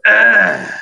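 high, loud pained grunt; royal guard grunt. Retold or imitated. Imitating the royal guard's dying grunt. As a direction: grunt like a guard taking a hit. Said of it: "sounds like a guy grunting".

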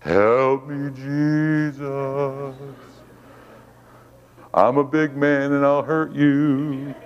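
drawn-out, held-level plea; restrained threat plea. Retold or imitated. Preacher retelling his prayer while angry at doctor, restrained plea. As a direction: stretch each word out, holding back.

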